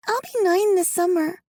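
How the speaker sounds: put-on high child voice, a bit weary; little girl Alice. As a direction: speak as a young girl telling her story.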